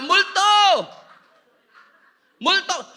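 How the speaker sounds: high, loud cry of 'ghost!'; yelled high at full volume; panicked shouting. Acted. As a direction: shout it out like frightened disciples. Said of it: "sumigaw sila".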